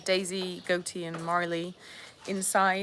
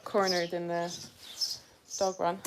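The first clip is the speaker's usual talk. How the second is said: ordinary talk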